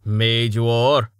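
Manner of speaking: mock his words with air quotes; sardonic. A narrator's reading. Stavo mocking Reason's phrasing, sardonic. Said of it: "Stavo said in a sardonic tone".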